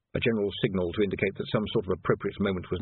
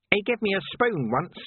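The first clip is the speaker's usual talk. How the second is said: high, nasal-free small-man pitch lift; Nobby's reedy voice; 12 semitones higher than usual. A narrator's reading. Narrator voicing Nobby at the graveside, high and wistful.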